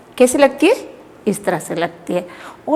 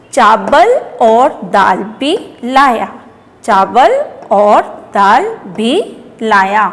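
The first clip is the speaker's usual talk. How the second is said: word by word, long vowels stretched and rising; slow primer reading; 3 dB louder, slower than usual. Shown as a demonstration. Teacher reading for children to repeat, slow and segmented. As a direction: read slowly with a pause after each word.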